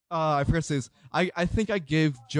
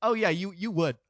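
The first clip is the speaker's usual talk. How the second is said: passive-aggressive; 3 semitones higher than usual. Retold or imitated. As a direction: agree with a veiled dig, imitating them. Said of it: "the most passive aggre".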